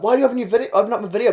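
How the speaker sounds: high, loud indignant question; mock demanding complaint. Acted complaint from someone on Snapchat.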